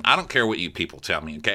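usual speaking voice

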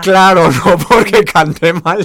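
loud, laughing, slow. Real, not acted. Singer laughs telling the host it wasn't bad singing. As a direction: laugh through the words. Laughing at her own joke.

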